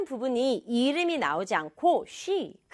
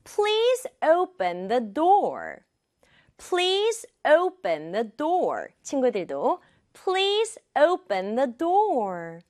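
raised "Please", "open" and "door", slow and rhythmic; stressed content words; 3 semitones higher, much slower than usual. Shown as a demonstration. Teacher leading students to repeat, slow rhythmic stress. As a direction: punch "Please open door", keep "the" unstressed. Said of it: "아, 나는 강조하지 않는다".

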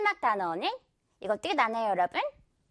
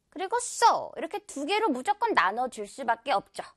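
normal talking voice

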